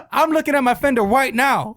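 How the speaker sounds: loud, high-pitched indignant insistence; angry. Retold or imitated. Coworker arguing with prank caller over fender, angry. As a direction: imitate an angry man insisting. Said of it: "Why are you so angry".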